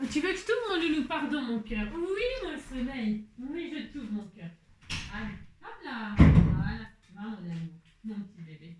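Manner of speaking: sweet nothings murmured to a pet. Real, not acted. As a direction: coo gently to a little animal while letting it out. Talking to her pet at the door.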